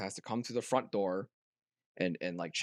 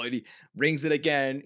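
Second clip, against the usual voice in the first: ordinary talk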